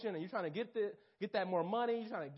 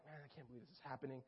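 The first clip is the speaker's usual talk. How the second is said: fast despairing mutter; 6 semitones lower, 14 dB quieter, faster than usual. Retold or imitated. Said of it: "I'm like".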